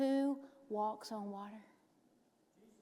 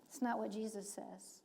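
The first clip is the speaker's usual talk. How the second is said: ordinary delivery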